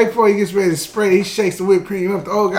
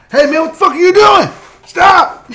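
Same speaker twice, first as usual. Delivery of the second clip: much higher, louder bystander yell; mock outraged shout; 11 semitones higher, 8 dB louder than usual. Bystander yelling at the stroller hitter, mock outrage. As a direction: stop him, outraged.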